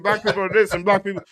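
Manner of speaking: mocking hack comic. Imagining himself trashing his own people, hammed-up high fast voice. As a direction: ham up a sellout comedian on stage. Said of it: "to be able to be like".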